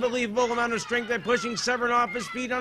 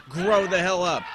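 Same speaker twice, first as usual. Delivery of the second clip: ordinary talk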